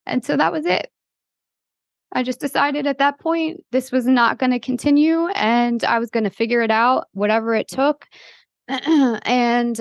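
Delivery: fighting through a lump in the throat; choked up. Real, not acted. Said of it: "this one will probably get me choked up".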